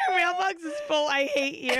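struggling to speak for laughing. Real, not acted. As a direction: read the message out through hysterics. Repeats quote while cracking up.

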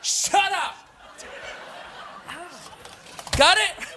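high, loud yell at everyone; furious shout. Acted. Silencing the bickering coworkers, a furious high shout. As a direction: scream them into silence.

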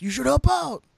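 loud, high-pitched mock of the co-host; Andrew voice. Host mimicking his co-host urging listeners, loud Andrew voice. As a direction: shout it as a hammed-up impression. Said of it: "this is my Andrew voice".

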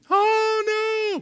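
high wailing cry of grief; crying aloud. Retold or imitated. Preacher voicing weeping Israelites, high loud wail. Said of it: "crying aloud".

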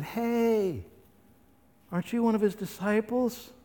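high, curious question; lightly voiced servant girl. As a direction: point him out casually.

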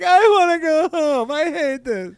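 mock homesick whine. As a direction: whimper like a crying kid who wants to go home. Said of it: "That's how I define homesickness".

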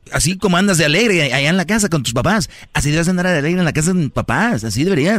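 high, mocking jealous wife voice. Acts out the jealous wife's reproach. (mujer celosa burlona con voz aguda)

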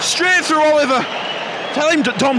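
high, loud urging call; excited shout. Real, not acted. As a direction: call it out in the heat of play. Live football chance, urging.

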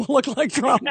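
breaking up with laughter mid-line; quick words dissolving into giggles; laughing. Real, not acted. Host repeats the joke to the caller, laughing.

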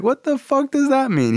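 high-pitched incredulous dad; outraged bafflement. Retold or imitated. Dad to Carl about prison remark, incredulous. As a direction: demand an explanation, voice shooting up.

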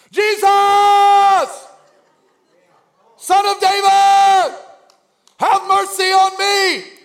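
long, high, full-voiced shout; desperate plea hollered over a crowd; crying out. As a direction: shout it out, stretching each name. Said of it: "he cried out".

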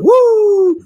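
slow, high, loud wrestler yell; wrestler woo. Pretend. Host slips into a wrestler voice and whoops loudly. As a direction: drag out the brother and whoop like a wrestler. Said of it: "I don't know why I'm talking like this".

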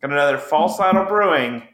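usual speaking voice

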